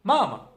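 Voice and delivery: Mama with the first मा high and loud; mama, stressed up front, falling away; first-syllable stress. Shown as a demonstration. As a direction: hit the first मा of mama, drop the second. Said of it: "तो हम उसे बोलेंगे मामा".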